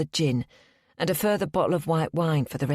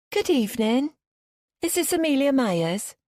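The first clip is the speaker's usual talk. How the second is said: higher put-on Liverpudlian bank caller; Liverpudlian accent; 10 semitones higher than usual. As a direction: fake a polite Liverpool call-centre voice. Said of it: "I put on a strong Liverpudlian accent".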